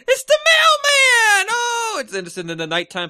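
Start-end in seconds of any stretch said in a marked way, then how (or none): 0.1-2.0 excited shout. Retold or imitated. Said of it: "it was like".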